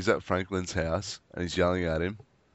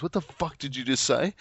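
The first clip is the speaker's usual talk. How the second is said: pissed off; 7 semitones higher than usual. Retold or imitated. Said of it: "he's pissed off still".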